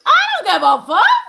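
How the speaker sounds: ordinary talk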